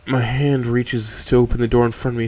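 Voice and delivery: plain reading voice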